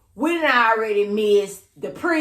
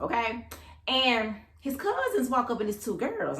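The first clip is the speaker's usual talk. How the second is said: ordinary talk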